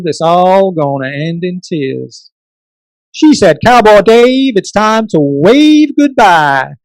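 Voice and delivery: put-on light cowboy accent; hint of a country cowboy accent. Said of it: "I'm gonna try and sound like, a little like a cowboy".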